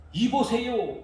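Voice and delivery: 이보세요 high, falling, curt; rude hey you. Retold or imitated. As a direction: snap 이보세요 instead of father. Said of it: "그러는 거예요".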